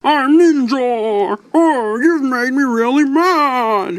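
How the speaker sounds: high, slow cartoonish mock rage; angry Ninjor voice. Toy reviewer mimics Ninjor getting mad, high hammed-up cartoon anger. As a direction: ham up a goofy cartoon warrior losing his temper. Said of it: "when Ninjor would get really mad and really angry".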